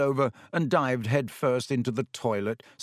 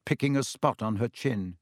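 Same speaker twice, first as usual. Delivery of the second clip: ordinary delivery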